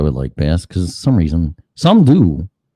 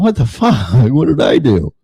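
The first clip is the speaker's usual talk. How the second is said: lightly voiced, higher wounded dog with a laugh; hurt old dog; 6 semitones higher than usual. Old dog reacting to the new puppy, lightly voiced. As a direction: play the confused old dog, a bit higher. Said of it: "the eight-year-old dog's going".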